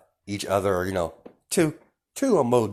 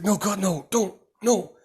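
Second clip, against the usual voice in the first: high, louder desperate begging; panicked pleading; 11 semitones higher, 4 dB louder than usual. Acted. The leader begging Deku not to, high and panicked. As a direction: plead in rising terror.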